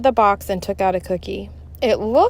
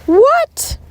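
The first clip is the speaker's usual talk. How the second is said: shocked; 8 dB louder than usual. Acted. Shocked kids.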